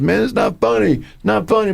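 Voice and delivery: high, whiny stranded driver; whiny protest. Retold or imitated. Stranded driver whining to his laughing buddy, high and sulky. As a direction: complain like a sulking kid, way up high. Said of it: "starts laughing and goes".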